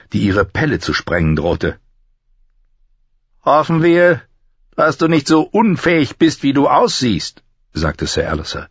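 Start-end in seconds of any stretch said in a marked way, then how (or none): 3.4-7.4 high-pitched, sneering drill master; mocking master-at-arms. Master-at-arms ridicules the fat new recruit, mocking. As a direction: jeer at the recruit in a raised, cutting voice.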